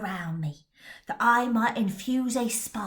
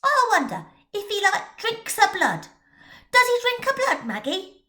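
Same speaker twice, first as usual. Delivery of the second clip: much higher and louder lad voice; a kid hungry for gore; wide-eyed boy asking; curious boy; 11 semitones higher, 7 dB louder than usual. Acted. Tommy asks eagerly.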